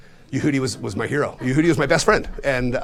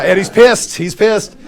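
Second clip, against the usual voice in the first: louder, driving the point home; loud emphasis; 9 semitones higher, 11 dB louder, slower than usual. Real, not acted. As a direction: punch it out to the room. Retelling an angry reaction.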